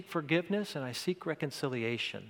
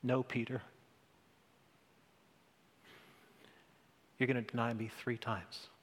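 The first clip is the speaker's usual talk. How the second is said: softer, tender and grave; earnest; 3 semitones lower, 8 dB quieter, faster than usual. Acted. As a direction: gently tell Peter the hard truth. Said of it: "looked at Peter in all earnestness".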